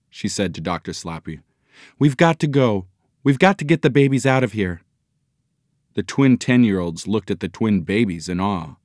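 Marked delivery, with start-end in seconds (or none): 2.0-4.8 pitched-up, hurried urging; urgent, raised-pitch insistence. A narrator's reading. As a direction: press your husband to leave now. Mother urging the family to flee.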